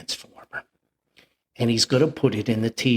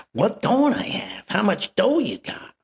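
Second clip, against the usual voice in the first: peddler voice; 9 semitones higher than usual. Retold or imitated.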